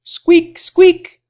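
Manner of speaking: very high, tiny squeaking; mouse squeak. Acted. Reading to children, the little mouse squeaks very high. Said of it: "goes the little mouse".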